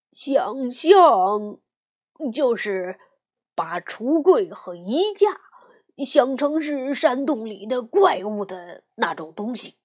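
lightly voiced patient bear dad; papa bear voice. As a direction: explain like a kind father. Papa explains.

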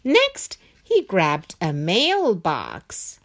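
usual speaking voice

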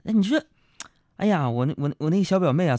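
ordinary delivery

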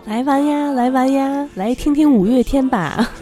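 put-on tourist tout in Taiwanese accent. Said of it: "拉大陆游客的那种".

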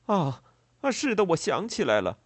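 loud, high-pitched embarrassed recollection; flustered. A narrator's reading. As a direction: blurt it out, caught off balance. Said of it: "农人狼狈地说道". (狼狈慌张地承认)

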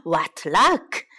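plain reading voice

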